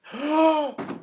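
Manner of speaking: sharp high gasp of surprise; shocked gasp. Real, not acted. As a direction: gasp as if caught off guard. Sees the butter tarts.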